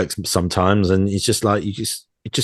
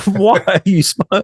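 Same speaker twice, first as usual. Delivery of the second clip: slow, high, loud indignant question; exaggerated, drawn-out affronted challenge; mock annoyance; 11 semitones higher, 5 dB louder, slower than usual. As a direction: stretch out the demand in hammed-up exasperation. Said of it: "I get annoyed".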